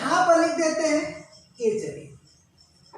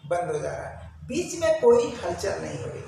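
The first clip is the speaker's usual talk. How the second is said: usual speaking voice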